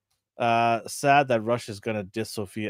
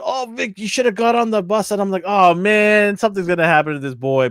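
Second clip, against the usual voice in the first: excited retelling; 9 semitones higher, 6 dB louder than usual. Real, not acted.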